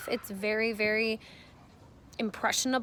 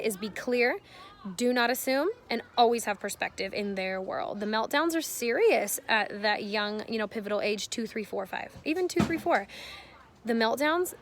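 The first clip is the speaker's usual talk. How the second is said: ordinary delivery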